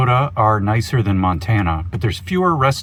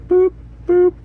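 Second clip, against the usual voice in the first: high, squeaky boop boop; playful sound effect of swishing wipers; wiper noise. Pretend. Imitating windshield wipers.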